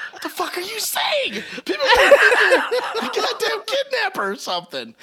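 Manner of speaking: laughing imitation of an alarmed partner; mock alarmed partner. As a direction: squeal it like a scandalised partner.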